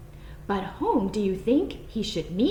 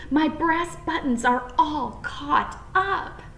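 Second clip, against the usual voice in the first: tearful bunny; 6 semitones higher, slower than usual. A narrator's reading. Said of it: "sobbed Peter".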